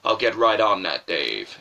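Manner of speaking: low, loud sarcastic reply; deep-voiced game partner scoffing. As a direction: drop the voice and mock-agree. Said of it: "he's sarcastic as well".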